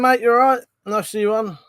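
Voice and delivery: ordinary talk, nothing marked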